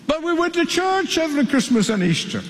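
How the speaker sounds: high, whiny plea at the shut door; pleading foolish ones. Acted. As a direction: beg to be let in, pitched high.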